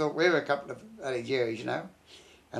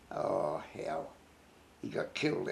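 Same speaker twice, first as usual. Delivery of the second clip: grief; 8 semitones lower, 10 dB quieter, slower than usual. Real, not acted. Recalling a friend's death.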